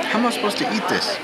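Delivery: normal talking voice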